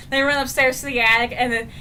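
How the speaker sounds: ordinary talk, nothing marked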